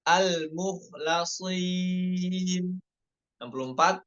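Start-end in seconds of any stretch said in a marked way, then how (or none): 0.1-2.8 very slow drawn-out chant; Quranic recitation. Shown as a demonstration. Teacher reciting the word slowly. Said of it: "Nomor enam puluh tiga".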